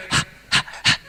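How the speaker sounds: panting dog. Retold or imitated. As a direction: pant quickly like a happy dog. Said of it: "And then he goes".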